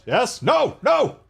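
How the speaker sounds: rising cheer, then a high dismayed cry; excited shouting. Real, not acted. Cheering a kill, then it escapes.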